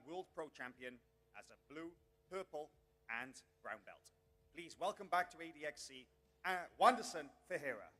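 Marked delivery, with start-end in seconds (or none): 4.5-7.9 booming ring-announcer welcome, louder at the name; announcing the fighter's name over the room; announcer call-out. Real, not acted.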